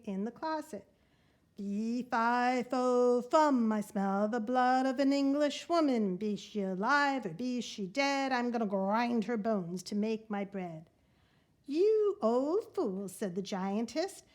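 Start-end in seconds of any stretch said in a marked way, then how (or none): 1.6-10.9 loud, heavy giant chant, each word pounded out; booming giant. Acted. Giant sniffing out the hidden girl, booming chant. 11.7-13.1 higher, louder, slow mock scold; scolding wife. Giantess scolding her giant husband, slow mock scold.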